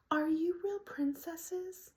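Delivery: put-on high, slow, wide-eyed child voice; tiny high-pitched kid asking sweetly; little three-year-old girl. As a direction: ask it like an awed little girl, drawn out. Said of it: "precious little three-year-old girl".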